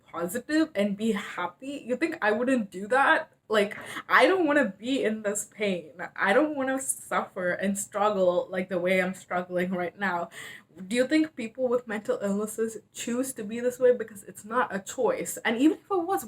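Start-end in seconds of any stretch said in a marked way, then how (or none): none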